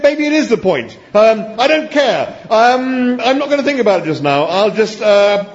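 high, loud, exasperated backtracking; flustered dithering. A narrator's reading. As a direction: give in crossly, then flail for words. Flustered Arthur concedes, then dithers.

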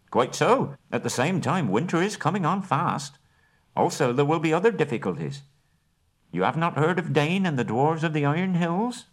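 high, precise hobbit negotiator; business manner. Acted. Bilbo bargaining with the Elven King and Bard, businesslike. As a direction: lay out terms like a careful merchant.

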